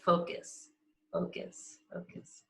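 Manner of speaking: fading whisper. Shown as a demonstration. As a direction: let it fade to a breath. Repeats word fading to whisper.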